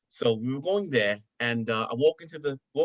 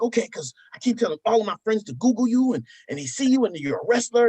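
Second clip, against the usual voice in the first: high, louder imitation of an excited kid; proud son; 11 semitones higher, 6 dB louder than usual. Son telling friends to google dad, high excited imitation. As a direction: brag like a child about dad. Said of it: "He was like".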